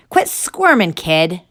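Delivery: loud, high barked order; barking. Acted. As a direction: bark it at the kid. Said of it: "the red-headed guard barked".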